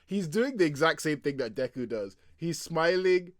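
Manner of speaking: usual speaking voice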